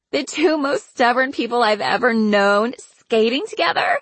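high-pitched incredulous question; mild shriek. A narrator's reading. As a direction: raise the voice in amused disbelief. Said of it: "Karina shrieked".

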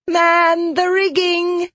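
high, drawn-out playful command; giggly captain's order. Acted. Marrow playing captain to her crew, high gleeful order. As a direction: sing out the order in a high childlike voice. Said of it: "she giggled".

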